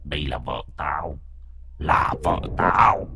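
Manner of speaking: slow, soft possessive ghost moan; ghost wail. Acted. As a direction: drag it out like a spirit. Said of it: "giọng ma quái ghê rợn".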